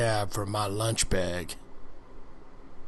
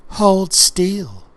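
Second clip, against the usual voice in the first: sharp, louder, higher order; commanding; 8 semitones higher, 8 dB louder than usual. Acted. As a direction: snap an order to freeze. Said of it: "she commanded".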